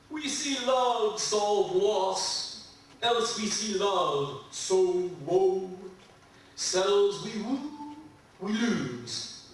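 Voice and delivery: slow, measured love poem reading; poetry reading. Acted. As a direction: linger on each phrase tenderly.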